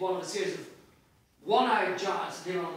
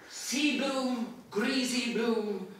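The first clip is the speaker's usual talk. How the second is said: raised, loud performed reading of Bloom's thoughts; dramatic reading; 4 semitones higher, 5 dB louder than usual. Acted. Reading Bloom's end aloud to an audience, performed and loud. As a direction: read it as a rising musical finale. Reads Bloom's musical ending.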